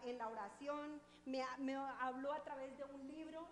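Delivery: loud, full-force preaching. Real, not acted. Preacher tells the congregation, preaching loudly. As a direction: drive the testimony home to the church.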